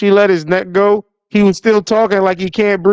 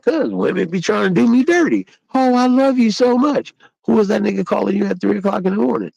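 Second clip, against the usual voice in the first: mock woman voice; 5 semitones higher than usual. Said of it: "voices a woman then confronts".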